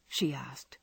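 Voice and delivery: ordinary delivery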